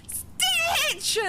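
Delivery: very high, drawn-out excited squeal; fangirl squeal. Real, not acted. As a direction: squeal the name like a thrilled fan. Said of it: "as fangirlish as possible".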